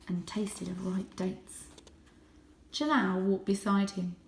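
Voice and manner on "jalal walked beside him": usual speaking voice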